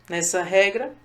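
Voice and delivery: ordinary talk, nothing marked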